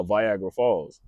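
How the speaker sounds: Niagara said as "Viagra", straight-faced; deliberate mispronunciation. Real, not acted. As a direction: say "Viagra" for Niagara, slowly and matter-of-fact. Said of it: "I say it with a straight face".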